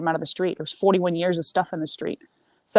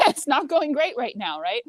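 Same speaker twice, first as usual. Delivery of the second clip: ordinary talk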